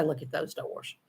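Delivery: those doors whispered, proper grammar; whispered written version. Shown as a demonstration. Vlogger whispering how she would write it. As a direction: whisper the correct written form. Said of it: "If I wrote it, I would say".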